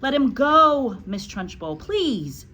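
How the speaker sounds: high, loud pleading; loud anxious plea; begging. A narrator's reading. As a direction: beg her to let go. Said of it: "begged Miss Honey".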